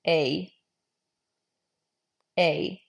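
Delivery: long pure "A" vowel, held steady; Middle English A. Shown as a demonstration. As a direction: say "A" as one clean sound, no glide. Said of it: "They together make the sound A".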